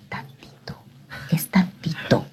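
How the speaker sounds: soft coaxing whisper; tempting whisper of the flesh. Retold or imitated. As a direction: coax like temptation itself. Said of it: "tu carne, que te dice". (susurro tentador de la carne)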